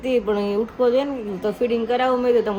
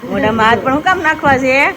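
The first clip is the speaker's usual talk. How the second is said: high, louder teasing coo; 4 semitones higher, 4 dB louder than usual. Real, not acted. Teasing baby about hand in mouth, high playful baby talk.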